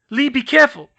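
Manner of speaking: high, loud anxious cry; worried warning. Acted. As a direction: warn him in alarm.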